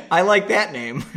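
giggling through the words; amused, laughing as you say it. Real, not acted. Reader cracking up at the name Zub-Zub, laughing.

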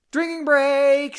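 high, loud, drawn-out announcement; jubilant call-out. Pretend. Host calling a break mid-chapter, high drawn-out call. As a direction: sing out the words high and long.

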